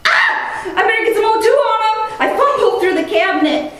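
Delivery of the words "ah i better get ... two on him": high, fast, flustered cry with a laugh; panicked rush. Retold or imitated. Medic scrambling to oxygenate the patient, flustered and fast.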